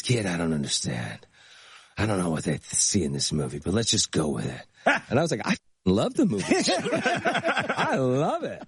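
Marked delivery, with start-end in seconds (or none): 0.0-4.6 low-pitched impression of an older movie star; Burt Reynolds. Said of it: "So he goes". 5.4-8.6 high-pitched, laughing protest of love; gushing enthusiasm. Retold or imitated. Acted reply defending the movie to Burt.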